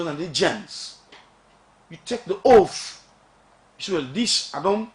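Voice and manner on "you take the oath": slow and loud, every word hit; emphatic. Real, not acted. Explaining the oath to listeners, slow loud emphasis. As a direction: drive it home slowly. Describing dual citizenship oath.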